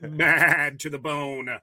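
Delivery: slow punchline, milked; telling a dad joke. Acted. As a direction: drag out the punchline for groans. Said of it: "It's dad joke time".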